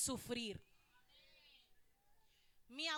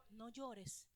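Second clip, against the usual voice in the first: softer still, hushed "No llores"; gentle no llores; 6 semitones lower, 13 dB quieter than usual. Shown as a demonstration. Jesus comforting the widow, hushed and tender. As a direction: hush "No llores" like calming a crying mother. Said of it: "¿Cómo le dijo?".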